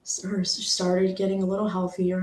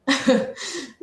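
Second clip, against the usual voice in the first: brief higher-pitched laugh; short laugh; 6 semitones higher, 4 dB louder than usual. Real, not acted. Recalling a last visit with her, short laugh. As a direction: let a quick laugh escape.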